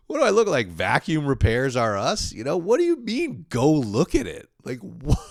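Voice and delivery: loud, high comic rant; mock indignation. Comic objecting to girlfriend's vacuum request, mock indignant. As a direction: rant in incredulous mock outrage.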